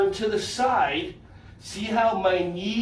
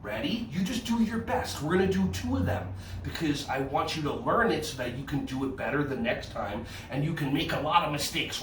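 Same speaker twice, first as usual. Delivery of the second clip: ordinary delivery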